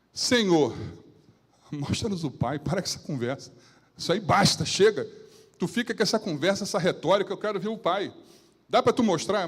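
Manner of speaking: cobrança alta e impaciente, em voz forte; Filipe impaciente e atrevido. Acted. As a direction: exija como quem perdeu a paciência. Filipe exige ver o Pai. (Filipe cobrando Jesus com descaramento, em voz alta e irritada)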